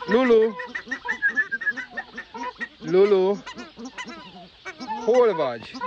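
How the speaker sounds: high, loud shout of a name; calling loudly. Real, not acted. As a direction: shout for the lost bird. Shouting for missing bird. (magas, hangos kiáltás)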